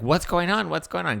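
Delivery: lightly acted panic; mock freak-out. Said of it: "[name] is freaking out".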